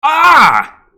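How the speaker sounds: sudden high furious shriek; angry shriek. Real, not acted. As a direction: shriek out in outrage. Outburst at game opponent.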